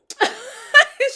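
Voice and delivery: a loud, high burst of laughter. Real, not acted. Laughing about her devil-on-the-shoulder friend. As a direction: crack up loudly.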